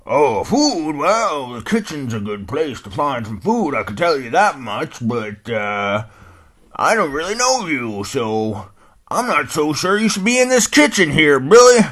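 put-on puzzled old dog grumbling; old hound dog. Old hound dog puzzling over the name, loud and folksy.

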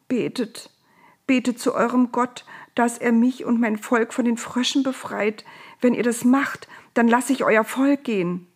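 high-pitched pleading; whimpering. A narrator's reading. Pharaoh under his blanket begs Moses for help, whimpering. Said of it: "hörten die beiden nur ein Wimmern".

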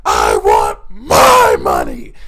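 very loud, slow bellow, imitating a raging boss; imitated screaming boss. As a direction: roar it with weight on MY. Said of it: "he would just yell and scream".

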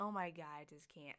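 ordinary delivery

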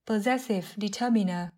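normal talking voice

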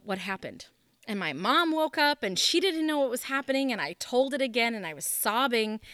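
usual speaking voice

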